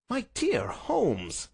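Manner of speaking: high, drawn-out exclamation; shocked protest. Acted. Watson objects to hiding behind the bed, shocked.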